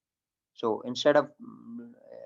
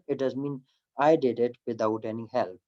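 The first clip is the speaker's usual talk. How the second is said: ordinary delivery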